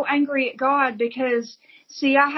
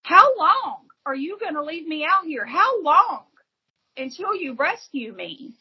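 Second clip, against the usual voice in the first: higher, pleading cry to the heavens; crying out to God; 6 semitones higher than usual. Retold or imitated. Woman on the street crying out to God, high and pleading. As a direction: call out to God in desperation. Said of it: "I'm screaming at him".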